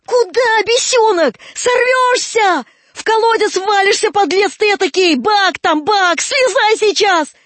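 high, loud yelling; alarmed angry shouting. Acted. Old man orders climbing boy down, alarmed angry shouting. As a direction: yell up at a disobedient boy in danger.

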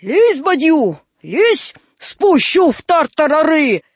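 put-on high, loud menacing old man; angry threat. Old man threatens a caught boy, angry threat.

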